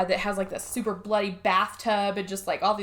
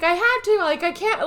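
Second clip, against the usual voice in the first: pleading excuse; 10 semitones higher than usual. Retold or imitated. Boss answers Finn in retelling.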